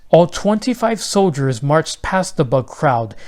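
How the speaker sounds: usual speaking voice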